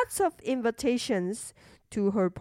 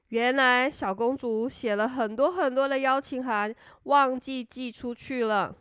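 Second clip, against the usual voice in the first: plain reading voice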